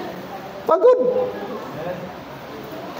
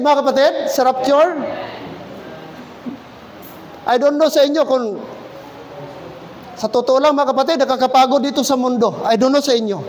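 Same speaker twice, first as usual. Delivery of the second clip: ordinary talk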